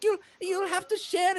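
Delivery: very high little voice; apologetic, high-pitched hosting; halfling hostess. Acted. Hostess short of cups to guests, apologetic.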